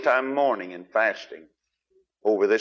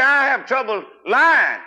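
high, loud plaintive confession in a changed voice; loud mock plea; imitated complainer; 12 semitones higher, 6 dB louder than usual. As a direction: cry it out like a pleading church member. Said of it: "You say".

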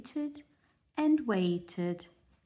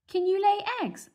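high, loud bossy hen; high clucking farm hen; 13 semitones higher, 6 dB louder than usual. Acted. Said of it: "asked a hen".

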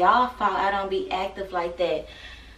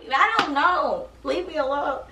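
mock whine; 7 semitones higher than usual. Flustered after mispronouncing.

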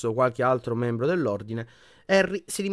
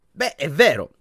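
a louder outburst; blurting out; 5 semitones higher, 7 dB louder than usual. A narrator's reading. Ginny confronting a sulking Harry, blurting. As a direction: burst out with it. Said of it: "sbottò".

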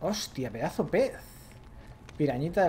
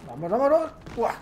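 excited urging; 7 semitones higher, 4 dB louder than usual. Real, not acted. Urging on during a fight.

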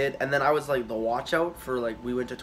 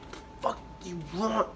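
inner voice; 5 semitones higher, 7 dB quieter, faster than usual. Retold or imitated. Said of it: "in my head".

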